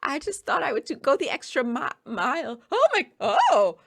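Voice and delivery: high, gushing orc voice; high-pitched fabulous orc; flattered orc. Acted. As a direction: play the orc basking in praise. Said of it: "and she says".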